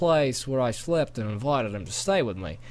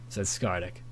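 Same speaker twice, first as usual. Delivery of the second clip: ordinary delivery, nothing marked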